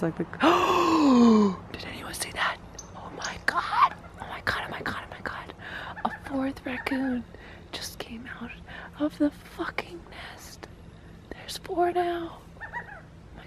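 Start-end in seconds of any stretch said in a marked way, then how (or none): 0.3-1.7 long, real gasp of amazement; startled gasp. Real, not acted. Sees another raccoon come out. 1.7-13.5 hushed, real disbelief breaking into high squeaks; very soft, excited whisper; astonished whisper. Real, not acted. Fourth raccoon just came out.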